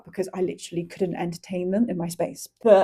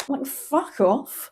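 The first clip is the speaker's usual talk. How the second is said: mock outrage; 5 semitones higher, much slower than usual. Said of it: "I'm getting Andalusian rage".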